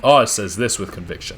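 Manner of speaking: ordinary delivery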